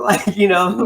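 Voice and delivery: ordinary talk, nothing marked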